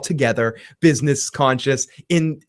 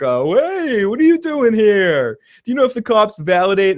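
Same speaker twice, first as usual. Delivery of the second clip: higher, eager and chirpy; cheery Price; 8 semitones higher than usual. Retold or imitated. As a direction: bounce in like a clueless eager guy. Price greets Mike cheerfully.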